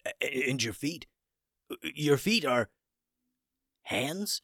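rising, halting disbelief; shocked. A narrator's reading. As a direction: stumble toward the last word in amazement. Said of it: "continued to appear shocked".

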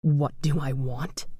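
slow, low, breathy phantom murmur; ghostly whisper. A narrator's reading. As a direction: menace close to her ear. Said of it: "a voice near her ear whispered".

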